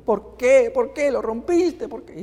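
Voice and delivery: put-on high, pleading child's wail; a little boy sobbing why; crying child. Boy whose boat was broken, crying high and pleading. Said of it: "se puso a llorar el niño".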